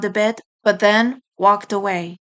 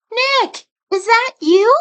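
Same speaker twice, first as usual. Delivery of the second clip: put-on high young female rabbit voice, louder on the question; hopeful call as the sister; 13 semitones higher, 5 dB louder than usual. Sister recognizing Nick at the grave, high hopeful call. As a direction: reach out with his name, then ask. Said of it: "a familiar voice called".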